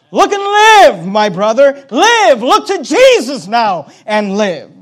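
preaching at full force. Acted. Preacher calling the congregation to Jesus, fervent. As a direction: urge them to look and live.

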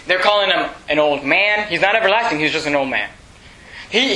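ordinary talk

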